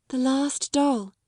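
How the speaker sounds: mournful. A narrator's reading. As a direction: say it slowly like a lost child. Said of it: "her little mournful voice had an odd sound".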